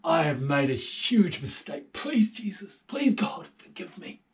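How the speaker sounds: David begging God to forgive him; mild pleading prayer. Acted. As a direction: beg forgiveness. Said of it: "the solution was prayer and saying".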